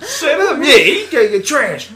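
loud, high-pitched heckling taunt; mock fight taunt. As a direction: shout them down in mock aggression. Acts out trash as a jab.

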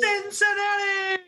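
radio ID shout. Pretend. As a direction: belt the city name like a station jingle. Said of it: "the guys that does".

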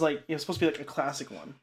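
normal talking voice

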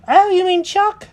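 very high, loud cartoon cat; Jenkins surprised. Cat recognises the squirrel, high loud surprised voice. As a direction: pipe up loudly in surprise, way up high. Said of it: "Jenkins goes".

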